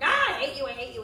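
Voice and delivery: imitating a yelling fan. Fans yelling at the young actor, loud mock yell. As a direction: hurl it like an angry fan. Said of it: "and yelling at him".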